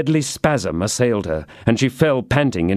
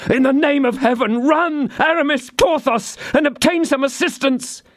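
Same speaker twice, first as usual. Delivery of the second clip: high, urgent plea for help; frantic call. Acted. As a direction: beg them to run for help. D'Artagnan calls for help.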